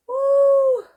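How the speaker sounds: high, loud cheering woo; happy woo. Real, not acted. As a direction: cheer with delight. Cheering her painted idea.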